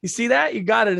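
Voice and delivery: high, loud proud cheering; proud dad cheering his goalie daughter; excited praise. Retold or imitated. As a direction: pump the kid up after her saves. Dad praising after saves.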